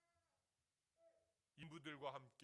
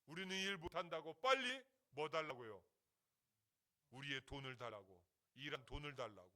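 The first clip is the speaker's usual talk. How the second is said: firm, raised voice of protesting workers; workers' loud demand; 12 dB louder than usual. Retold or imitated. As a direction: demand the wages loudly. Workers refuse work, demand pay.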